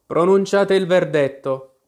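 plain reading voice

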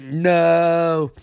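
high, drawn-out "no" of mock despair; retold wail, pitched well up; mock cry of protest. Hero begging villain not to drain his power, mock cry of protest.

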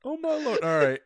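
high, helpless real laughter in the voice; laughing. Real, not acted. Podcast host cracked up by a guest's joke, laughing. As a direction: gasp it out through laughter. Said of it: "but I'm laughing".

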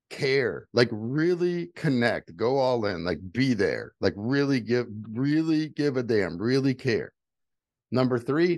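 normal talking voice

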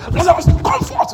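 high, commanding shout; loud voice. Acted. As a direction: shout it like raising the dead. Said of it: "loud voice".

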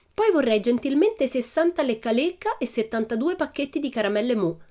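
put-on high, lively child customer; confident Pippi. A narrator's reading. Pippi ordering from the assistant, confident and high.

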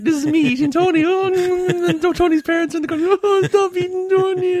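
very high, loud, sustained wail; gleefully overdone, high-pitched re-enactment; annoying caricature. Retold or imitated. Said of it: "It's so annoying".

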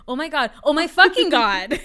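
hammed-up, loud outburst with laughter; mock exclamation. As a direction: build to an over-the-top exclamation. Imagines someone exclaiming the phrase.

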